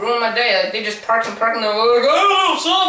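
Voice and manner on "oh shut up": high, exaggerated squeal; pitched-up mock outburst; mocking imitation. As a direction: mimic them in a high voice. Mimicking annoying people walking.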